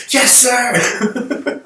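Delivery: squeaky high snap of a soldier; mock soldier reply. A soldier at the back answers the general, high and mock. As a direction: answer like an eager little soldier.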